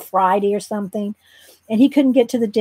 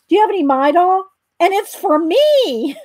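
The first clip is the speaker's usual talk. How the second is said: excited; 6 semitones higher, 3 dB louder than usual. Retold or imitated. Excited toothache sufferer asking the druggist for Midol, thrilled. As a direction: imitate a thrilled customer proudly announcing himself. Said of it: "'cause he was so excited that it worked".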